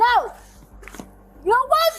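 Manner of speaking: yelling. Real, not acted. Agitated patient yelling at medics.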